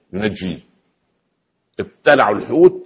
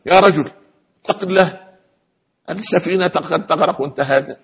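wife screaming; 9 semitones higher than usual. Retold or imitated. Wife grabbing her calm husband on the sinking ship, shouting. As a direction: shriek at him as the ship rocks. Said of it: "زوجته بدأت تصرخ".

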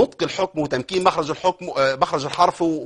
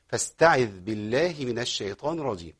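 recitation; 4 semitones lower, 6 dB quieter than usual. Shown as a demonstration. Teacher recites the verse.

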